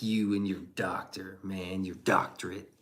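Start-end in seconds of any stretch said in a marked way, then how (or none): none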